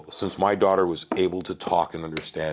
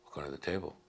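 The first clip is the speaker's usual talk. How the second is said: very soft, lower-pitched aside; secretive hush; 4 semitones lower, 16 dB quieter, much faster than usual. Retold or imitated. Girl at the table to her mom, secretive hush. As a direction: imitate a sly kid, barely voiced. Child's secret instruction, retold.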